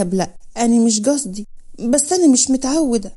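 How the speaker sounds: ordinary delivery, nothing marked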